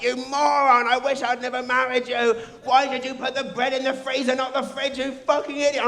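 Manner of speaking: high, loud mock tirade; imitating wife yelling about the bread; imitating angry wife. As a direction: berate him like a fed-up wife. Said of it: "she's like".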